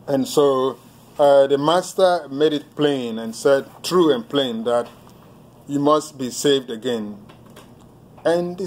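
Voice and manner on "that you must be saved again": ordinary delivery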